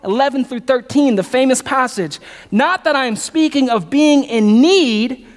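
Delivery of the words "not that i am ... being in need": slow reading. Acted. Reading scripture slowly.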